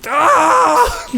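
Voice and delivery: sudden high scream, far above the normal voice; mock scream. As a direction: let out a short, shrill-pitched fake scream of pain. Screams after the quote.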